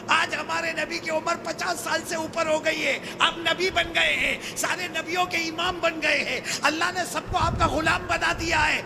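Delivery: high, loud preacher's voice; impassioned preaching. Acted. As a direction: preach it out to the crowd. Preaching to children at full force. (پرجوش خطیبانہ انداز)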